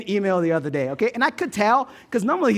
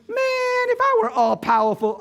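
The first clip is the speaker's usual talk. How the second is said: mock know-it-all; 13 semitones higher than usual. Someone griping at the world, sudden high mock boast. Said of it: "think to yourself".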